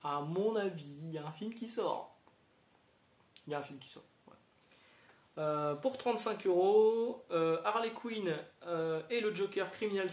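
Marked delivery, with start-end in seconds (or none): 3.5-4.3 quiet aside. Real, not acted. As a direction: mutter it again like a knowing aside. Repeats the remark as an aside. (en aparté, plus doux et plus grave)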